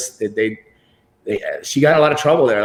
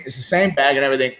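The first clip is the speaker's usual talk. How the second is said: ordinary talk, nothing marked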